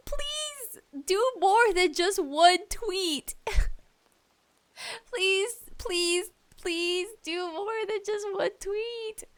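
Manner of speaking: high, slow whiny begging; pleading. Real, not acted. To the publisher, high slow whiny begging. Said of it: "pleading with publisher".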